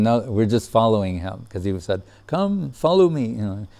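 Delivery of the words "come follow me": raised-pitch retold call; higher, lightly acted beckoning; imitated summons. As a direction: quote him calling them along, pitched up. Retells the call to follow.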